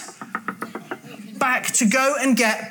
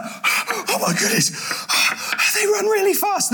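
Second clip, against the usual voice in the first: acted panting, high then dropping; mock out of breath; 7 semitones higher than usual. [name] left behind by fast runners, mock breathless. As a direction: puff the words out as if after a run.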